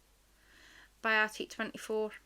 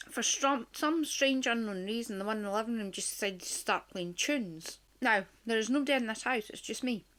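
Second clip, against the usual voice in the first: ordinary delivery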